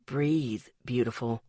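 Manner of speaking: calm her down gently; calmly. A narrator's reading. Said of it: "he told me calmly".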